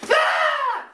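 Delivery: sudden high yelp for the cat's attack; imitating the cat going off with a shrill ah; cat attack screech. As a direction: jump up high with a short cry.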